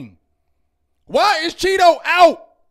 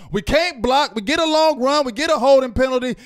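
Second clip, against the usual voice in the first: normal talking voice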